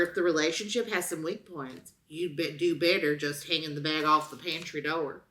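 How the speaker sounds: ordinary talk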